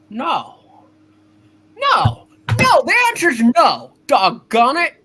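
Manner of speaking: angry refusal. Acted. George shouting down Potter's offer, furious. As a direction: slam the door on the offer with a furious yell.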